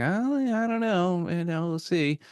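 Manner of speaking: high, breezy mock-casual voice; a carefree guy shrugging off bad news, airy and high; unbothered shrug. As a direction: toss it off lightly, way up high. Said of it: "And the guy's like".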